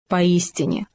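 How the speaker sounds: ordinary delivery, nothing marked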